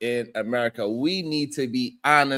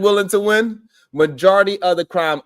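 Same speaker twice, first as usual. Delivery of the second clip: normal talking voice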